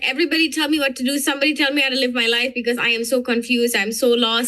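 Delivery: fast, pleading rush of words; rapid, half-mock plea for someone to take over; confused mode. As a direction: gabble it like someone lost and begging for direction. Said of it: "I go into this mode".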